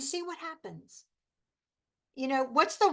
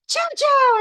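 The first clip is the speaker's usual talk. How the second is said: put-on very high, loud child's squeal of a name; imitating little girl; 12 semitones higher, 10 dB louder than usual. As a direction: squeal the name like an excited toddler. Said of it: "it's so cute when she says".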